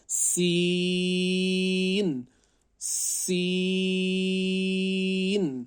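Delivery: teacher chanting a long سین; stretched recitation. Shown as a demonstration. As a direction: draw out سین like Quran recitation. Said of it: "اس کو کھینچنا ہے".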